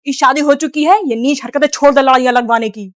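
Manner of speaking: loud, scolding mock husband; angry son. Son angrily scolds his mother to defend his wife.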